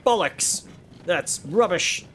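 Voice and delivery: high, hammed-up indignant retort; mock defiance. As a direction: refuse like a stubborn, put-out rebel. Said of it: "And I'd be like".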